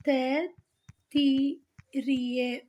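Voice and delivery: very slow, separated Taittiriya; syllable by syllable. Shown as a demonstration. Teacher dictating to students as she writes, syllables spaced out. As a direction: break Taittiriya into "tai-tti-ree-ya". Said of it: "जिसे हम पढ़ते हैं".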